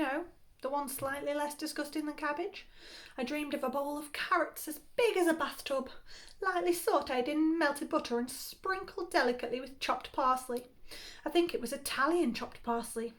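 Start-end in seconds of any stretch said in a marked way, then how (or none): none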